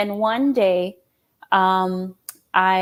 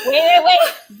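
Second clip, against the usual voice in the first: high, laughing wait wait; excited realization, laughing; laughing excitement; 10 semitones higher, 6 dB louder, slower than usual. Real, not acted. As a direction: stop them, laughing.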